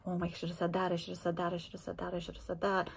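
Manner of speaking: acted-out obsessive inner chatter; ruminating. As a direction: repeat it like a stuck thought. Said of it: "getting into a cycle of thoughts".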